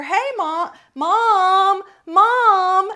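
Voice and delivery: mock kid yelling for Mom, high and loud; child calling out. Ten-year-old son yelling to his mother from the bathroom, imitated calling out. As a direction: holler it like an impatient kid, drawing attention.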